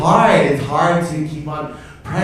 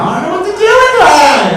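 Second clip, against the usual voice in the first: exasperated parent; 8 dB louder, faster than usual. Acted.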